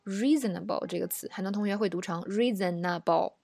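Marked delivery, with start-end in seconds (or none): 2.2-3.3 reasonable as four equal syllables, ree-zen-na-bo; syllable-by-syllable Chinese-style reading. Shown as a demonstration. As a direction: give every syllable of reasonable equal full weight. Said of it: "把它的音节当中文来读".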